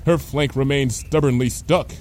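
ordinary delivery

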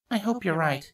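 usual speaking voice

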